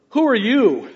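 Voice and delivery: "Who" hit high and loud up front; emphatic who. Shown as a demonstration. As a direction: push the word "Who" to the front. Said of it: "He fronts these Greek words to give them emphasis".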